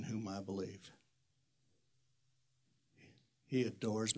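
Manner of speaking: soft, slow and low, hushed tenderness; softly sincere closing words; tender. Real, not acted. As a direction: speak it gently like a quiet confession.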